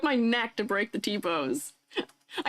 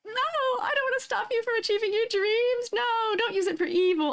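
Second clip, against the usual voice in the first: much higher, whiny protest; mock innocent protest; 10 semitones higher than usual. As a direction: plead innocence in a cartoonish voice. Protesting chat accusation.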